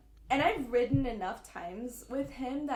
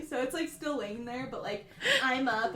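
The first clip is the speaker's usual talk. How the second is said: ordinary delivery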